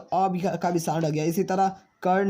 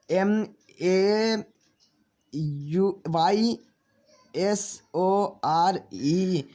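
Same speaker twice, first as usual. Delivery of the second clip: slow spelling; much slower than usual. Shown as a demonstration. Teacher spells letters out slowly.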